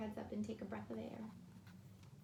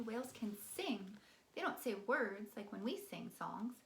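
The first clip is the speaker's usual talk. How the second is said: ordinary delivery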